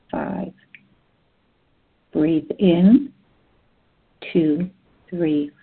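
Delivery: ordinary talk, nothing marked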